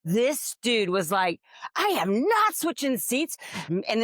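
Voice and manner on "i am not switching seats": high, indignant mock huff; gruff refusal. Rude passenger refusing to swap seats, indignant. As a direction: snap back like a put-out stranger.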